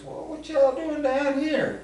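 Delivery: hammed-up Southern accent asking; Southern local. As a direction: imitate a curious Southern stranger in a Southern accent. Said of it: "He goes".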